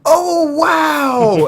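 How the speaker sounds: loud, high, drawn-out cry of amazement; amazed outburst. Retold or imitated. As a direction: burst out as if stunned in public. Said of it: "I just went".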